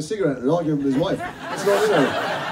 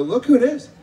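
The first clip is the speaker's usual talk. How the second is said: higher, louder delighted exclamation; excited parent; 8 semitones higher, 6 dB louder than usual. Retold or imitated. Parent spotting the actor in a shop, excited call. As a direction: greet him like a thrilled parent. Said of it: "And they go".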